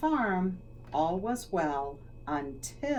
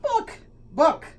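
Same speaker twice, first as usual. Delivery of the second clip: high, loud "Book, book" like a cluck; clucking chicken; 9 semitones higher, 10 dB louder than usual. Acted. Hen repeating her request to the librarian, clucking. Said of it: "clucked the chicken".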